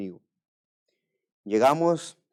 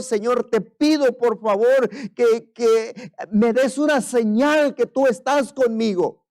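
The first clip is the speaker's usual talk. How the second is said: pleading prayer, rising louder and higher; tearful prayer; 11 semitones higher, 7 dB louder than usual. Retold or imitated. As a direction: beg God with a breaking, urgent voice. Pastor retells tearful prayer to God. (oración suplicante con llanto)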